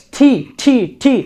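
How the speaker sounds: "ঠি" with a strong breathy puff; demonstrating the aspirated ঠি sound. Shown as a demonstration. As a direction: hit "ঠি" hard with aspiration. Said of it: "ঠ এ শিকারে ঠি বলবো".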